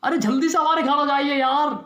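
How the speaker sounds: high, stammering plea for help; panicked urgent call. Acted. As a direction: call the doctor in a panic, pitch climbing. (घबराहट में मदद की पुकार)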